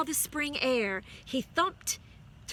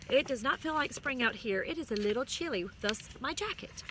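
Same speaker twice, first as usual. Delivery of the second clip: ordinary delivery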